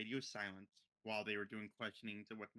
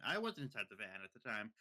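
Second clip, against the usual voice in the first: ordinary talk, nothing marked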